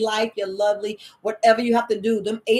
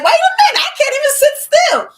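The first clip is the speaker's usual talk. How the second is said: much higher and louder, jolted outcry; startled exclaim; 10 dB louder than usual. Retold or imitated. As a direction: yelp it like the patch just stung. Patches making her sore, quoted reaction.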